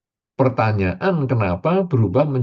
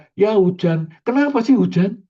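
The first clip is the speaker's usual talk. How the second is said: higher, irritated grumble at rain; annoyed complaint; 8 semitones higher than usual. Retold or imitated. Preacher mimicking a worker grumbling at the rain. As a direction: sulk about the rain.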